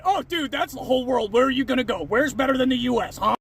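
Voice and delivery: mocking commenter. Reading a commenter in character.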